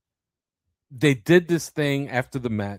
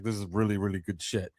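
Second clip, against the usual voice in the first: a low, hushed hint of the old boss; lightly voiced Vince, dropped low and soft; Vince McMahon; 4 semitones lower, 9 dB quieter than usual. Host quoting Vince praising a promo, low and hushed. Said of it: "like Vince McMahon would have said".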